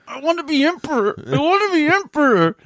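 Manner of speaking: high, whining would-be emperor; whiny emperor. Retold or imitated. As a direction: whine it like a spoilt prince. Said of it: "just like".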